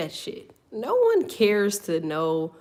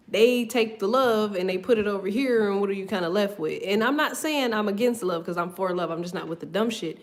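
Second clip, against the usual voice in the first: ordinary talk, nothing marked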